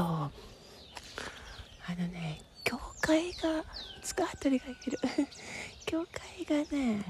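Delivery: hushed walking whisper. Real, not acted. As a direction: whisper while climbing.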